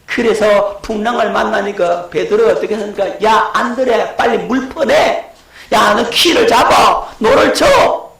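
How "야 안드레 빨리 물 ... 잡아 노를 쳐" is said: frantic orders shouted over a storm; urgent shouting. Acted. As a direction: bark commands at the crew, rising in panic.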